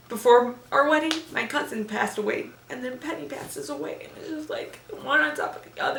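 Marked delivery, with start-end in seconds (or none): 2.7-6.0 crying. Real, not acted. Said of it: "Enough of my crying".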